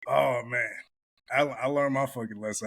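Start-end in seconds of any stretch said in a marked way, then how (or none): none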